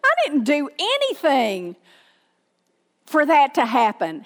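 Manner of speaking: mock incredulous protest; acted bewilderment. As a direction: play the baffled victim of someone lashing out. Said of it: "And they're thinking".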